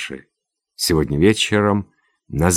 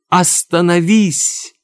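very high, loud, drawn-out scream to stop; horrified shout; 12 semitones higher, 7 dB louder, slower than usual. A narrator's reading. General as Olga aims the knife, horrified shout. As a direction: cry out in horror. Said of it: "вскричал в ужасе Берон".

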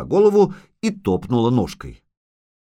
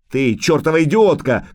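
higher, louder burst of anger; angry shout; 5 semitones higher, 5 dB louder than usual. A narrator's reading. Witcher berates dryad for shooting, shouting angrily. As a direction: shout it at the culprit. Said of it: "крикнул ведьмак".

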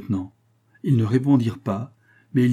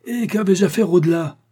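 loud, high-pitched protest; indignant outcry; 9 semitones higher, 5 dB louder than usual. A narrator's reading. Astronomer protests to the lieutenant, indignant outcry. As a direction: cry out in exasperation, pitch right up.

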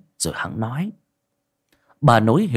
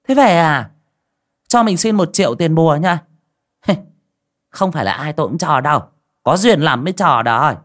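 put-on higher, louder greedy old sorceress with a chuckle; sly sorceress; 8 semitones higher, 5 dB louder than usual. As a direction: wheedle the fee out of her, higher and louder. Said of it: "bà thầy túm lại". (bà thầy đòi tiền bùa, giọng cao, to, mỉa mai)

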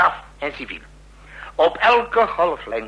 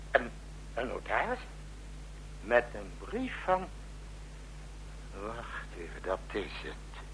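much softer, low muttering while reading; half to himself, barely aloud; 4 semitones lower, 17 dB quieter than usual. Acted. Commander reading the notary's letter, muttering to himself.

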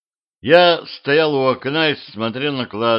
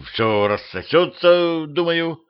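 slow, higher-pitched hesitant mumble; drawn-out unsure reassurance; mumbling uncertainly; 7 semitones higher, slower than usual. A narrator's reading. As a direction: offer feeble comfort, dragging the words. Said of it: "промемлил я".

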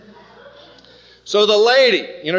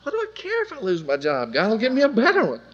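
carefree; 5 semitones higher than usual. Retold or imitated. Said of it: "I was totally unconcerned".

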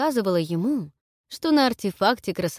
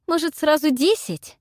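louder, high, biting sarcasm; sarcastic; 9 semitones higher, 6 dB louder than usual. A narrator's reading. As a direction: jab it back sarcastically. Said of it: "съязвила я".

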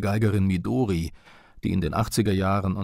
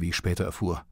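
normal talking voice

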